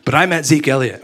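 ordinary delivery

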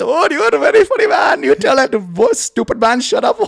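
high, loud, gushing accented impression; hammed-up giggly coworker cheering on a friend; excited mock-accented shouting; Hassan. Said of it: "he's a giggler".

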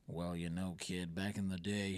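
low, slow, soft veteran voice; put-on older storyteller, pitched right down; old-timer reminiscing. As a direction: reminisce to a youngster, deep and unhurried. Voices older Han telling a flashback.

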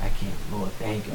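soft, understated tearful prayer; hushed, mildly choked-up gratitude; retold tearful thanks. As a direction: thank God quietly, overcome. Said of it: "I'm crying".

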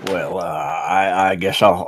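ordinary talk

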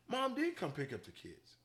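ordinary talk, nothing marked

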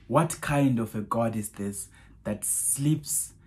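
ordinary delivery

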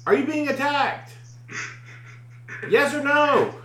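high, insistent calling out; re-enacted scream. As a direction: holler for an answer from afar. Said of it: "I screamed".

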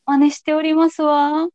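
playful noble-lady imitation; Salome ojousama voice. Shown as a demonstration. (お嬢様口調の真似)

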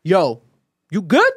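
gangster-style check-in, rising question. Retold or imitated. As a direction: imitate a tough friend checking in. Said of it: "[name] on some gangster shit".

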